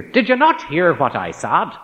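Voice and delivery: high, loud Northern Irish challenge; insistent Northern Irish accent. Retold or imitated. Student challenging the teacher who ignored him, insistent Northern Irish accent. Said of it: "blurted out in his north of Ireland accent".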